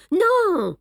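high, loud sharp denial; sudden loud protest; emphatic no. A narrator's reading. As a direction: cut him off with a firm no. Poirot contradicting Hastings sharply.

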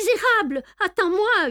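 very high indignant yell; howling threat. A narrator's reading. Hero chasing the man carrying off his things, howling. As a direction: yell it high at a fleeing thief. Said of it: "hurla le Tarasconnais".

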